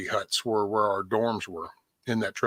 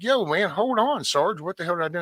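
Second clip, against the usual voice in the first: frantic plea; 8 semitones higher than usual. Retold or imitated. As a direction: beg him to hold on, voice jumping up. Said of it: "I'm like".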